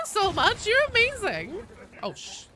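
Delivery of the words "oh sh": usual speaking voice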